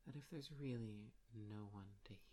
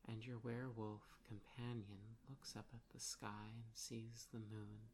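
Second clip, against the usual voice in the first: usual speaking voice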